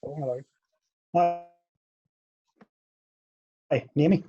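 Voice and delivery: ordinary talk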